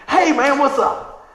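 big, bright hey man; loud greeting to strangers; loud friendly greeting. Retold or imitated. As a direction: call out warmly across the lot. Greeting guys at the mall.

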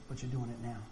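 "But you're doing it now" said softly, low in pitch, with "doing" leaned on gently; a calm, quiet reassurance; God's gentle reply. Retold or imitated. Preacher voicing God reassuring him, soft and low. God answers his regret.

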